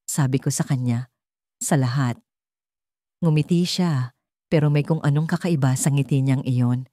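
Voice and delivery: plain reading voice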